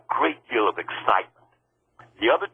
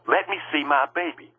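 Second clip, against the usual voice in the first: higher-pitched retold plea, not yelled; pleading demand, pitched up; first plea; 7 semitones higher than usual. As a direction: insist on it without shouting. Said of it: "And then I just yelled it out".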